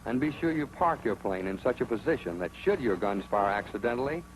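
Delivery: usual speaking voice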